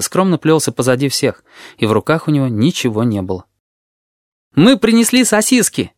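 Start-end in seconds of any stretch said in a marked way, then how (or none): 4.5-5.9 high cheerful announcement. A narrator's reading. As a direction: call it out happily. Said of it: "весело начал Горбач".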